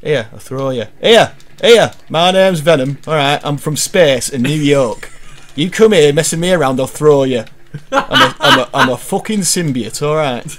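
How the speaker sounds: hammed-up northern English tough guy; lightly played northern Venom threatening; a northern English accent, half laughing. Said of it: "You sound like you're going all northern".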